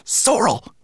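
high, hissed name; angry hiss. A narrator's reading. Rat snapping at the brownie holding her, hissed. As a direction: hiss it sharply, high and loud. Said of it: "she hissed".

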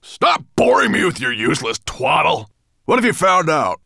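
impatient, bullying master; angry snarl. A narrator's reading. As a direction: cut off the servant with fury. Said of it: "snarled Nettlebrand".

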